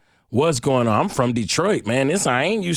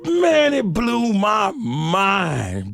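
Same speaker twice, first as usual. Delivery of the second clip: astonished outburst; 8 semitones higher, much slower than usual. Real, not acted. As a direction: shout it in disbelief, stretching the words. Exclaims at the shocking realisation.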